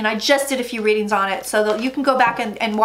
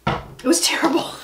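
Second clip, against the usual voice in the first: whisper; 3 semitones higher than usual. Real, not acted. Whispered opening complaint.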